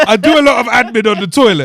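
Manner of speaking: loud, laughing confession; laughing admission. Real, not acted. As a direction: blurt it out through a laugh. Admits laughing, defensive.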